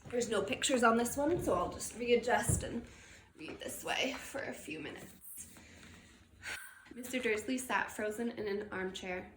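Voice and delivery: usual speaking voice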